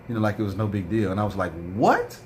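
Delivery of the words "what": much higher disbelieving what, rising; shocked disbelief, voice jumps up; reliving the moment of shock. Retold or imitated. Shocked reaction to passing worm.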